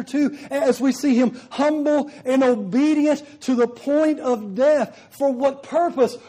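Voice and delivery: loud ringing scripture reading from the pulpit; preaching at full force. Acted. Preacher reading John twelve to the congregation, full-voiced preaching. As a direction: proclaim each verse to the back row.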